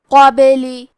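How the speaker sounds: "قابلی" with the ba bouncing in an echo; qalqala on ba. Shown as a demonstration. Teacher shows the qalqala echo on the ba. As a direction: let the ba bounce. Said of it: "یہ حرف کو ہلاتے ہوئے".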